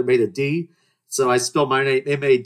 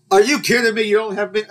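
disbelief; 10 semitones higher, 4 dB louder than usual. Retold or imitated. No mint for mojito.

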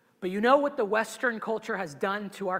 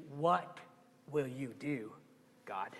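hushed prayer; 9 semitones lower, 13 dB quieter than usual. Acted. Voices a humble prayer.

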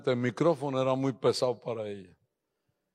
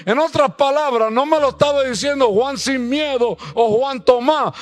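louder, higher, fiery sermon; full-force preaching; 9 semitones higher, 10 dB louder than usual. Acted. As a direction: preach it out to the whole church. (predicación a todo pulmón, más fuerte y más aguda)

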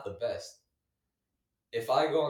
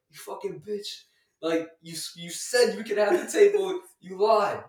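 high-pitched retold tirade; indignant outburst; 7 semitones higher, 8 dB louder than usual. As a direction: imitate a sore loser protesting loudly. Acting out the sore loser's outburst.

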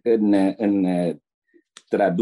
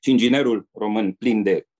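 ordinary delivery

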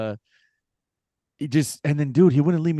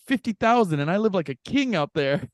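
lightly voiced proud stranger, a touch higher; mock proud boast; 7 semitones higher than usual. Imitating a stranger boasting to the teller about his salary. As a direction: brag it like it's a fortune, lightly.

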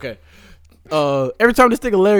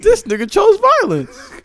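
laughing; 4 dB louder than usual. Real, not acted. Laughing reaction.

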